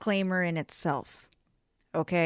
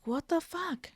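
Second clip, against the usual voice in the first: Caribbean lady; 9 semitones higher, 6 dB quieter than usual. Retold or imitated. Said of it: "like, Caribbean lady".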